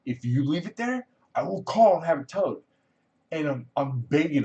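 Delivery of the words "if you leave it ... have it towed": retold threat, pitch raised; threatening. Hospital worker warning him about his parked car, mock threatening. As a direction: imitate an annoyed guy laying down a warning. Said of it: "Now the dude's threatening".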